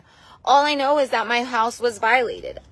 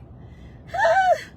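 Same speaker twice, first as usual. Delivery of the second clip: tearful sigh. Real, not acted. Emotional pause about supporter.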